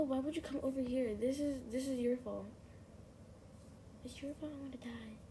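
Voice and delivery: normal talking voice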